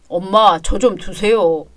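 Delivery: low, drowsy plea; groggy low-pitched man's voice; sleepy son. Acted. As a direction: plead half-asleep to be left alone. Sleepy son begs to be left.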